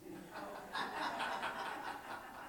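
a quiet breathy laugh at his own story; soft laugh. Real, not acted. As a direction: let out a soft chuckle, almost silent. Laughs after the sweetheart punchline.

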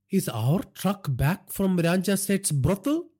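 high, slow, alarmed father's voice. A narrator's reading. Father asks after truck at murdered man's brothel, alarmed. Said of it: "Naresh inquired in an alarmed voice".

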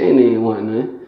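usual speaking voice

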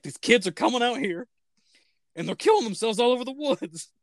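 high, mock-earnest explaining; imitating Tucker's excited theory; conspiratorial Tucker.

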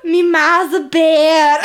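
slow, loud, high Scottish cry; hammed-up Scottish lament; Scottish wail. Brave movie line, Scottish.

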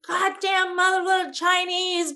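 high, slow mock snarl of a rude man; imitating an angry slur. Man in the theater row cursing at her, imitated high and slow snarl. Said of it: "And he went / lay into me".

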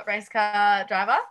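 ordinary talk

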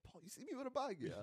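high squeaky worried voice; high panicked quote. Retold or imitated. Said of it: "I was just like".

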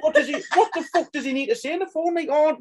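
high-pitched mocking disbelief; incredulous. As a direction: scoff through a laugh, pitched right up. Acting incredulous mockery of phone call.

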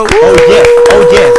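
put-on kids party magician. Podcast intro, showman flourish.

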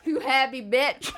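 slow, laughing mock catcall; mock street heckler. Comic pretending to holler at a happy girl, laughing. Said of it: "holler at girls and be like".

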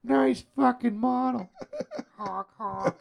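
high giggling honk honk; silly clown. Retold or imitated. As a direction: laugh through a goofy quote. Quoting Beetlejuice while laughing.

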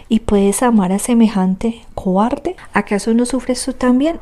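ordinary delivery, nothing marked

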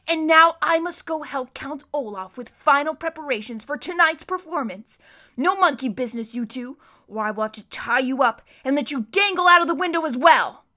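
loud, menacing thug voice; bully the children from the doorway; threatening henchman, loud. A narrator's reading. Hook-handed man threatening the orphans, loud and menacing.

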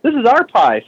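high, indignant retold scolding; pissed off. Telling asshole friend the pie is ours, pissed off. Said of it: "I was pissed off".

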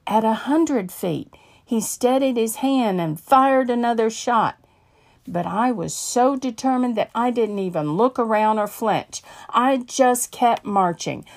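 ordinary talk, nothing marked

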